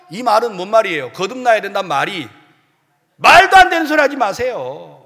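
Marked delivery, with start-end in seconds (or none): none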